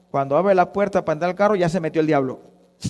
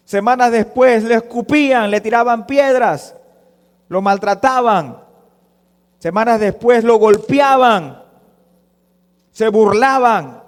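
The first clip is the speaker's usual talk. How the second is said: loud, raised, hammering delivery; forceful preaching; 4 semitones higher, 7 dB louder than usual. Acted. Preacher recounts the abuse of Jesus, full-force preaching. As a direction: hurl out each accusation loudly.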